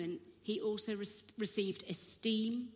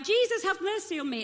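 acted loud, high plea; shouting out; 9 semitones higher, 7 dB louder than usual. Blind man calling to Jesus through the crowd, shouting out. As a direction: shout it out as the beggar. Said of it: "this guy's shouting out".

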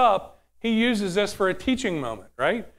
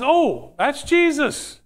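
dawning realization; 6 semitones higher, much slower than usual. Acted. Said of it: "And then Peter realizes".

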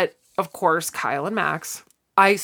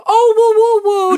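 much higher, louder party whoops; excited whooping; 8 dB louder than usual. Retold or imitated. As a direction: cheer it to everyone at the wedding. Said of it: "and Sinclair was like".

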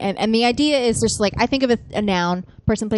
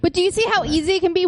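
fast excited; 9 semitones higher, much faster than usual. Real, not acted. Game host gloating.

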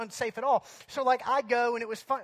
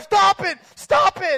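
high, very loud exasperated shout; yelling; 10 semitones higher, 13 dB louder, slower than usual. Retold or imitated. Lifeguard yelling at a kid on the slide. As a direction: yell it at a disobedient kid. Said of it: "he's like yelling at him".

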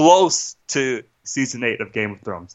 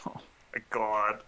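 breathy sigh into a whispered oh my God; exasperated whisper; 4 semitones lower, 13 dB quieter than usual. Real, not acted. Venting about the movie on the podcast, sighing whisper.

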